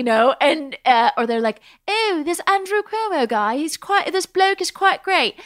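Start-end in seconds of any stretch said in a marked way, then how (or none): 1.9-5.3 high-pitched put-on British voice; hammed-up British accent, high and plummy; mock British accent. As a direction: play an admiring Brit, pitched way up. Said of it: "I wanted you to do other accents".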